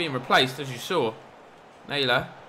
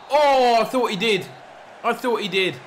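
excited cry; 9 semitones higher, 6 dB louder than usual. Real, not acted. Goal nearly scored, excited reaction.

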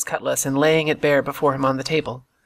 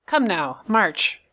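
mildly cruel order, pitched up on "march"; a touch of ugly, cold impatience; cruel, cold command; 4 semitones higher, much slower than usual. A narrator's reading. Blind man interrupts the frightened boy, coldly cruel. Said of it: "never heard a voice so cruel and cold and ugly".

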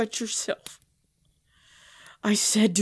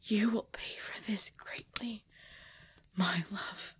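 whispered threat; 8 dB quieter than usual. Acted. Threat to her beloved.

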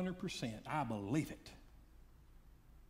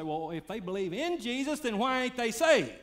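higher, louder questioning objection; skeptical listener; 9 semitones higher, 6 dB louder than usual. Acted. Preacher voicing a doubting listener's objection, rising loud. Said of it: "You say".

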